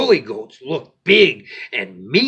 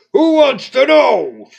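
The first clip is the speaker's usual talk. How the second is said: loud, high snarl; 9 semitones higher, 7 dB louder than usual. Acted. Rough snarls at Little Ogre, loud and high. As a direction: bite back at the ogre. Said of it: "snarled Rough".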